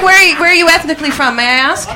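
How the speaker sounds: usual speaking voice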